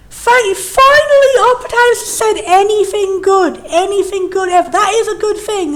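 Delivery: high and loud, slow drawn-out finally; excited relief. Real, not acted. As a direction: burst out in relieved delight. Relieved reaction to the game.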